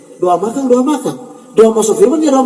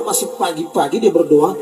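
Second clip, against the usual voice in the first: usual speaking voice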